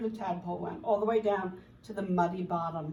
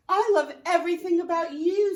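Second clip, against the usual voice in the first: higher and louder, a bright caterpillar; 7 semitones higher, 8 dB louder than usual. Acted. Caterpillar answers tadpole, higher and louder. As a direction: pitch it up and call it out. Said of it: "said the caterpillar".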